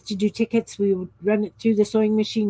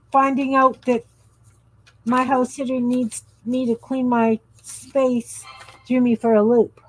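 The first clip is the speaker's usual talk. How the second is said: ordinary talk, nothing marked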